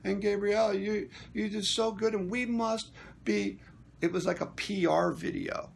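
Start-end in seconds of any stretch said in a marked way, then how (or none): 0.0-3.6 higher-pitched mock gushing; mocking suck-up praise; fawning flattery. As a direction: imitate someone sucking up, pitched up. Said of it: "it was just a stroke fest".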